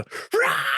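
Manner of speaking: high, piercing wordless yell; bandmate's random warm-up scream; sudden yell. Retold or imitated. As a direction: let out a sudden wild shout. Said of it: "tut der Tim einfach schreien".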